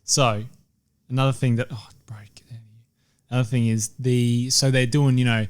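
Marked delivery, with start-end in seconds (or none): 1.7-2.6 hushed aside, barely voiced; real whisper. Real, not acted. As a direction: drop to a breathy whisper for a quick aside. Aside to someone nearby mid-recording.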